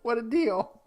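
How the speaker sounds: high-pitched laugh through the words. Real, not acted. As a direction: giggle out the line. Laughing at the joke.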